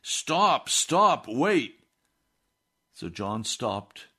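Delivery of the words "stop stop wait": high, loud calling after a wagon; yelling. Retold or imitated. Jim on horseback yelling at the wagon to stop, high and loud. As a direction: shout to make them stop.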